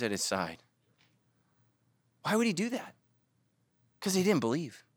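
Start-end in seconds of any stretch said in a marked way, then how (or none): none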